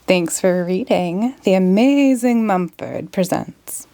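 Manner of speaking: ordinary delivery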